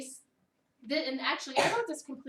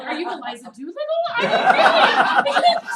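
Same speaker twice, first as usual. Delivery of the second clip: squealed high and loud through laughter; teasing a child while cracking up; mother laughing at her daughter's vowel; laughing tease; 17 dB louder than usual. Retold or imitated. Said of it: "And I was like".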